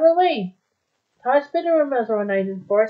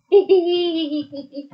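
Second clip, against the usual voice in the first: giggling fit; 3 semitones higher than usual. Real, not acted. Cracks up after the story.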